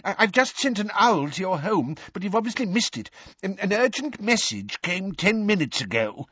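put-on high-pitched, stammering old wizard in desperation; desperate. A narrator's reading. Timid wizard bringing Arthur an urgent message, desperate. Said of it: "he said desperately".

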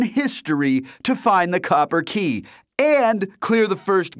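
high, loud, wild rant; excited friend H. A narrator's reading. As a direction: rant at your buddy for not calling. H raving.

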